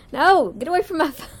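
loud, high scold at a cat; sharp scolding. Real, not acted. Scolding cat at her phone.

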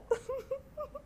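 high squeaky giggle. Real, not acted. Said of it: "laughing before it's even started".

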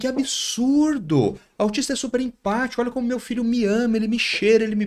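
mocking mother. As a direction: mimic an outraged mom defending her child. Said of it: "as mães falam".

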